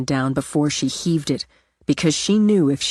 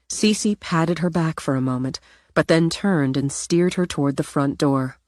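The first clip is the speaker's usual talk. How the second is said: usual speaking voice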